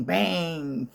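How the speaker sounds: ordinary delivery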